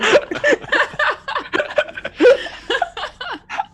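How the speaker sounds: high, loud cackling laugh; loud laughter. Real, not acted. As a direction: crack up loudly. Group cracks up.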